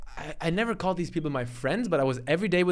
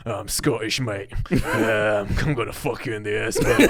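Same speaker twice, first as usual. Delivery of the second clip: deep, lower Scottish voice, rough and cocky; Captain Dom impression; 6 semitones lower than usual. Gamer imitating a friend's very deep Scottish voice. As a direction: growl it low like a Scottish tough guy. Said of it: "So I'm gonna imitate it now".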